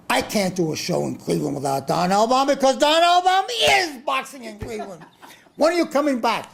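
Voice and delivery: high, pushy imitated promoter voice; mock Don King. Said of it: "he's screaming at everyone there".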